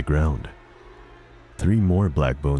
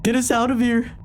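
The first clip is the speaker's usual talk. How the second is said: put-on kid shouting. Terrified kid shouts at the janitor to free him. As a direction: yell to be freed. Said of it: "he shouted".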